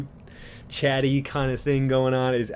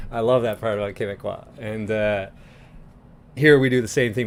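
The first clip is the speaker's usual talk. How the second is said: ordinary delivery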